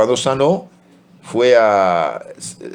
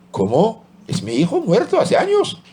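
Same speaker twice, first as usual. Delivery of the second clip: pitched far up in stunned disbelief; the question catches in shock; 9 semitones higher than usual. Acted. Mother seeing her dead son's photo, stunned high disbelief.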